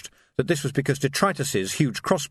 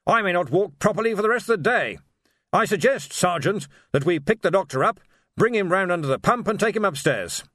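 put-on higher, pompous old wizard; blustery wizard; 7 semitones higher than usual. A narrator's reading. Ridcully voiced.

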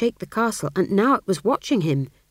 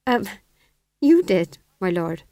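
timid, hesitant fool; nervous servant with a slight quaver; 4 dB louder than usual. A narrator's reading. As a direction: answer haltingly, afraid to contradict. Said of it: "he quavered".

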